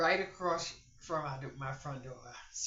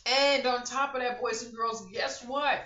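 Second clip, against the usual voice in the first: loud and high, calling out to the viewers; hyped-up host teasing a reveal; excited announcing; 9 semitones higher, 11 dB louder than usual. Real, not acted. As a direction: build it up like big news.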